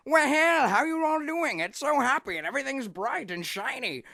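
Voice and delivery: high, loud, bouncy happy greeting; Lord Death. Retold or imitated. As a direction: chirp it out big and sing-song. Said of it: "he's always so like".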